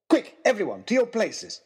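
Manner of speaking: rally everyone to action; mild commanding shout; urgent call. Acted. Calls out orders to workers.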